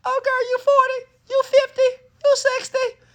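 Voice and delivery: put-on very high squealing party girl voice; birthday party squeal. Mimicking women at a birthday party, high squeal. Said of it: "for your girl's birthday party".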